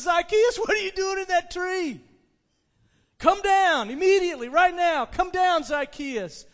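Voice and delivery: loud, high-pitched summons; calling out. Acted. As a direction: call urgently up to someone in a tree. Jesus calls up to tree.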